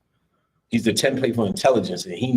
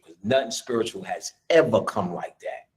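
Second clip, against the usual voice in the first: ordinary talk, nothing marked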